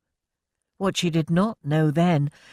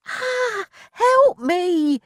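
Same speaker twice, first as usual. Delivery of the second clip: high, loud panicked screech; 5 dB louder, much slower than usual. A narrator's reading. Milly blasted by water, screeching for help. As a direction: shriek as the water hits. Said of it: "she screeched".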